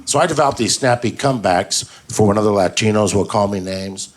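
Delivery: usual speaking voice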